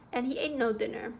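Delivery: ordinary delivery, nothing marked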